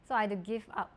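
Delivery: ordinary talk, nothing marked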